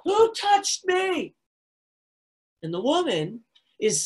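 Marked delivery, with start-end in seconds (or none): 0.0-1.3 high, slow, full shout. Acted. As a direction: bellow it so the whole crowd stops. Jesus shouts the question.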